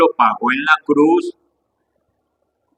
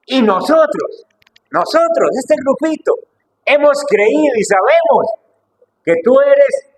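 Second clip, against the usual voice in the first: much higher, ringing, slowed on "Y nosotros" and building in force; Peter's bold confession; 12 semitones higher, 4 dB louder than usual. Acted. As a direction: proclaim it high and fervent, like Pedro speaking for his little group. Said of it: "sino que dice". (Pedro declarando con fervor, voz mucho más aguda)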